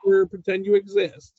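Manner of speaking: sarcastic apology. Retold or imitated. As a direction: grovel with heavy sarcasm. Dad sarcastically apologizing to daughter.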